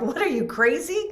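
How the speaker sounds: high, disbelieving retort; incredulous. Retold or imitated. To the trainer, high disbelief. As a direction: scoff at the odd question. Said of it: "I looked at him like".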